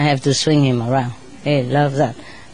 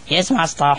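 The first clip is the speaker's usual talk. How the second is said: man's voice. Retold or imitated. Parrot mimics a man assistant answering, man's voice. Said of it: "say it in a man's voice".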